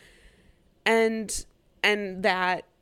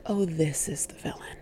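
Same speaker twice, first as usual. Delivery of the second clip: whisper; 4 semitones lower, 4 dB quieter than usual. Retold or imitated. Recognizing the villain.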